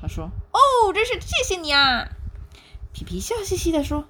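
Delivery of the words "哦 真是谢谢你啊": high, louder glad thanks; happy monkey. Acted. Monkey thanking the penguin, happy and high. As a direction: thank him brightly. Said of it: "猴子好开心哦".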